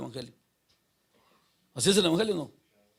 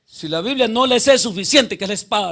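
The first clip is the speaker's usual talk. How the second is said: full-force preaching; 4 semitones higher, 14 dB louder than usual. Acted. Preacher driving the point home to the congregation, loud. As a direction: thunder it at the congregation.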